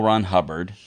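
usual speaking voice

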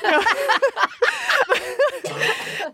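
high-pitched amused laugh. Real, not acted. Laughing at own quote.

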